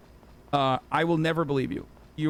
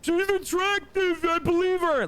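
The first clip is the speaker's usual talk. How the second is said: much higher, slowed mock voice of a gullible simp; dumb swooning viewer; much slower than usual. Streamer mocking gullible viewers, in a high dopey simp voice. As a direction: ham it up as a smitten fool falling for her.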